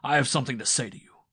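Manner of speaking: loud, fast and forceful; a threat he can't hold back; husband confronting his wife, angry; menacing husband. A narrator's reading.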